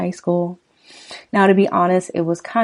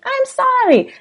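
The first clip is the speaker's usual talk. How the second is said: much higher, pleading wife voice, slow; voiced frightened wife; 5 dB louder, slower than usual. Host reading lyrics gives the wife a high, frightened plea. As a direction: pitch way up as the scared wife.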